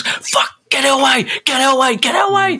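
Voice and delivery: shrieking high panic, pleading; panicked fans screaming to clear the ball; phobic panic. Retold or imitated.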